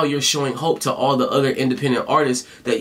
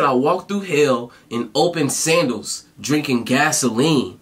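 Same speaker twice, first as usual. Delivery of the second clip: slow, deliberate quote of the lyric; slow corrected reread. Real, not acted. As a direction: savour each word slowly, this time right. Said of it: "I'm stuttering on my words".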